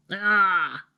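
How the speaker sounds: exasperated open "ah"; frustrated groan. Real, not acted. Reader fed up with a manga's plot turn, frustrated groan. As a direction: groan out your annoyance. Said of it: "I'm so mad".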